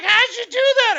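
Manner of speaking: put-on high, loud little kid in awe; amazed kid. Imitating the kid asking how he did it, high awed squeal. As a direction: squeak it like a dazzled child.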